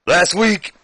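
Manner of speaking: high, loud mock wrestler promo; botched start of a wrestler impression; Booker T. Champion opening a promo against his rival, high and loud. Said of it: "it was a horrible Booker T start".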